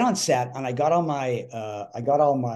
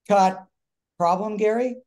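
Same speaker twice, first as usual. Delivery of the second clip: loud, higher call, rising into a question; director's interruption; 8 semitones higher, 5 dB louder than usual. Retold or imitated. Director halts the take to question the actor, loud and high. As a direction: stop the take, then query pointedly. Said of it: "Director goes".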